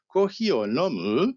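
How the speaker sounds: "Kohi o nomu" slowly, with "nomu" rising at the end; rising question intonation. Shown as a demonstration. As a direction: ask "Kohi o nomu", lifting the pitch on the final "nomu". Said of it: "tem que subir no fim". ("Kohi o nomu" em tom de pergunta, subindo no fim)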